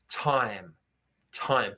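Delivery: "time" with a broad Cockney vowel. Shown as a demonstration. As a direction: widen the mouth on "time". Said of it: "just widening the mouth".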